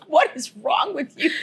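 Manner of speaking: imitating husband's indignant shout; husband shouting at her over the gum; mock outraged yell. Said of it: "He goes".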